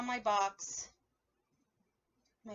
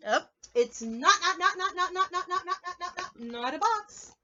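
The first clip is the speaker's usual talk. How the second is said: playful not not not; 7 semitones higher, 5 dB louder than usual. Acted. Reading the book's refrain playfully.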